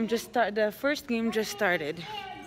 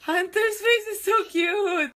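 higher, louder gush; delighted shout; 8 semitones higher, 6 dB louder than usual. Real, not acted. Mom gushing over her baby's face, loud.

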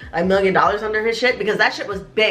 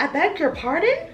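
"I beg your pardon?" pitched up and prim, lifting on "pardon"; mock refined politeness, voice raised; posh polite; 7 semitones higher than usual. Shown as a demonstration. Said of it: "I should have been like".

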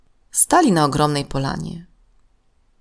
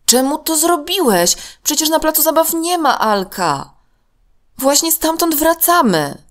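put-on much higher girl's voice, puzzled and reproachful; surprised; 12 semitones higher, 3 dB louder than usual. A narrator's reading. Tosia questioning her friend about his lie, high and puzzled. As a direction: press him for why he did it. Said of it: "powiedziała zaskoczona Tosia".

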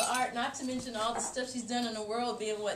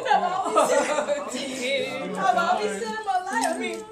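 laughing, louder, slowed as she speaks; laughing through words; 6 semitones higher, 10 dB louder than usual. Real, not acted. Granddaughter praising grandma, laughing through tears.

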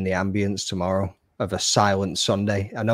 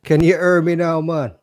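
hammed-up Jamaican accent, loud and high; mock Jamaican accent; 8 semitones higher, 7 dB louder than usual. Streamer teasing his guest in a mock Jamaican accent. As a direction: redo the mic check as a Jamaican caricature. Mocks guest again, then "Irie, man.

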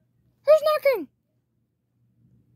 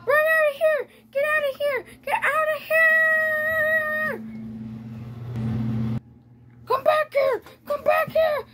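panicked yelling; 5 semitones higher than usual. Acted. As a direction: scream for your life while running. Panicked chase and escape.

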